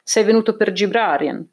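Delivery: plain reading voice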